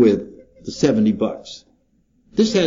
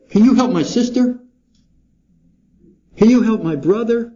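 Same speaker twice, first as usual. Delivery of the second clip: pleading request; 8 semitones higher than usual. Retold or imitated. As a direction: ask a favour hopefully. Imitating people asking favours.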